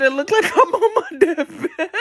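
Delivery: high, slow words broken by laughter; laughing through it. Real, not acted. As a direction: read the comment while cracking up. Quoting comment, laughing hard.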